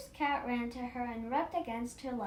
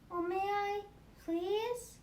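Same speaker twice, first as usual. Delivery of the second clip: a touch higher, eager hopeful asking; lightly voiced little girl; 6 semitones higher, slower than usual. A narrator's reading. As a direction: plead sweetly like a small girl asking permission. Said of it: "the little girl asked".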